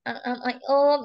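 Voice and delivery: mock halting stammer; imitasi terbata-bata. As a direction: stammer through broken filler sounds, imitating the pupil. (menirukan bicara terbata-bata)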